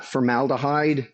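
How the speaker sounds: ordinary talk, nothing marked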